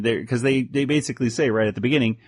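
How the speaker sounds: ordinary talk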